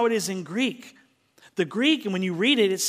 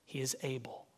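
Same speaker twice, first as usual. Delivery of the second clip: hushed; 4 semitones lower, 11 dB quieter, faster than usual. Real, not acted. Sermon, hushed assurance.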